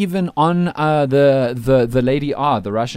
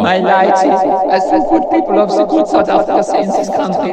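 odd character voice; 3 semitones higher, 5 dB louder than usual. Pretend. Visiting DJ greeting the radio hosts, odd character voice. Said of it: "do you actually speak like this".

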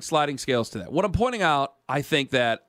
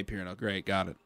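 sarcastic; 8 semitones lower, 10 dB quieter than usual. Real, not acted. Sarcastic aside to absent colleague.

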